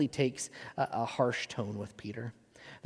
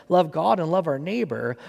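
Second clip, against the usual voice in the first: normal talking voice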